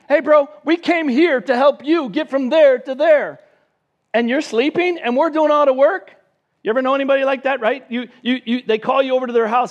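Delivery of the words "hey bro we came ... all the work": higher, louder, mock-offended buddy tone; indignant complaint. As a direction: call out a lazy friend. Disciples complain to sleeping Jesus.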